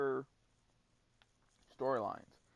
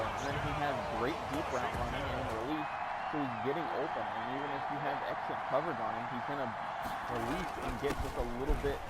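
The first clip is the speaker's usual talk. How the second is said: lively, louder sports call; play-by-play commentary; 2 semitones higher, 5 dB louder, faster than usual. Acted. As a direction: call the action like a broadcaster. Live play-by-play of own game.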